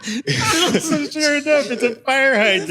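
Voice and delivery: high, loud, real laughter through the words; laughing punchline. Real, not acted. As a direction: barely get the punchline out for laughing. Punchline told through own laughter.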